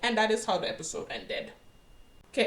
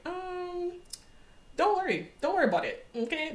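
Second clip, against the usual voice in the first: high-pitched mock-evasive reply; lightly acted "nothing to see here"; brushing it off; 9 semitones higher than usual. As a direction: wave away the question in a high voice. Evasive character's reply in a retelling.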